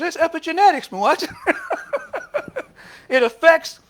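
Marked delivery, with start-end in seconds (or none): 0.0-1.3 high, loud, amused quote; laughing retelling. Retold or imitated. Preacher retelling what his friend said about plants, laughing. 1.3-3.0 real, high-pitched burst of laughter. Real, not acted. Preacher laughing at the epigenetics story, high laugh. Laughs at his own retold punchline.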